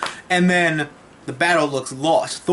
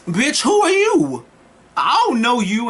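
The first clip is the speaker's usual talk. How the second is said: much higher, sneering mock villain; cocky Thanos; 7 semitones higher than usual. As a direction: toss it off like a smug villain who can't be bothered. Thanos voiced in a recap.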